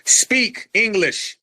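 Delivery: each word drawn out, very slow; slow, condescending command; slow, deliberate order. Acted. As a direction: spell it out slowly like talking to a foreigner. Host orders the caller to talk.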